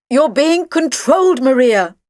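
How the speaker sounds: loud, high, slow shout. A narrator's reading. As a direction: shout it out slowly to break the spell. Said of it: "I shouted".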